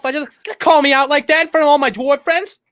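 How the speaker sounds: high, loud hammed-up indignation; mock outrage. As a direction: scold like an affronted wizard. Playing Gandalf's outraged rant.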